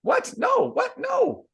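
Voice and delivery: very high shocked squeal; shocked disbelief. Real, not acted.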